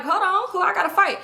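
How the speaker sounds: high-pitched defensive challenge; ready to fight. Retold or imitated. Woman whose shoulder was grabbed turns round, mock combative. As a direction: act out squaring up for a fight.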